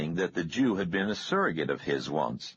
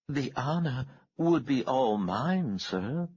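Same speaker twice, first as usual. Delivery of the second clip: higher, slow genteel Southern belle, a bit mad; simpering refined politeness, drawn out; Southern lady; 8 semitones higher than usual. A narrator's reading. Miss Fuller accepting invitation.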